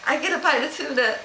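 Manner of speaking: high, eager little cat voice; excited pet voice; voicing the excited cat. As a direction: play the greedy cat begging for tuna. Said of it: "He's like".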